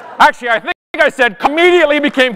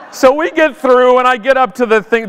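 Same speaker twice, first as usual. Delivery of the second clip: ordinary talk, nothing marked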